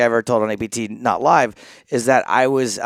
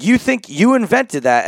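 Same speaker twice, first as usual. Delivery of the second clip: higher, slow mock English challenge; English accent; 9 semitones higher, 3 dB louder than usual. Player imitates an English stranger doubting him, in a bad English accent. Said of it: "I can't do an English accent".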